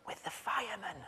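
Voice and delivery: small hushed whisper; hushed boy. Retold or imitated. Boy whispers to his dad's boss on the phone. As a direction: keep it secret-quiet like a hiding child.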